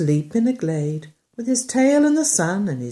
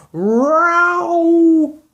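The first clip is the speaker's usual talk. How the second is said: high loud cat yowl; 10 semitones higher, 8 dB louder than usual. Acted. Cat yowl sound effect.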